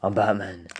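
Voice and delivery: low, quiet superhero growl; deep, hushed Batman. Acted. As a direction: state it low and soft, like a brooding hero. Playing Batman in a skit.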